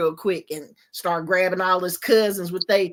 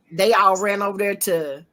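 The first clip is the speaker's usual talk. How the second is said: ordinary talk, nothing marked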